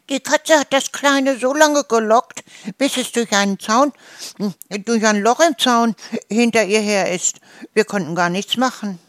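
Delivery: high, loud goose lament; whiny, tearful. A narrator's reading. Goose tells how the cat lured the chick, tearful. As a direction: wail it out in an agitated goose voice. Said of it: "erklärte Gisela weinerlich".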